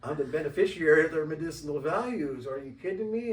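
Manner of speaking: loud, laughing mock outrage; defending his plants to the neighbor, laughing and loud; laughing indignation. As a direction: laugh it off as if it's obvious.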